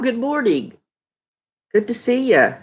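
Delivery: usual speaking voice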